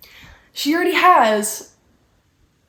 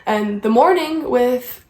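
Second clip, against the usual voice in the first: normal talking voice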